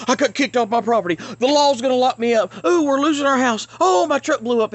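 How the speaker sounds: high, loud hammed-up alarm; mock panicked vlogger. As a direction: wail each disaster like a clickbait drama. Mocking another channel's weekly crisis.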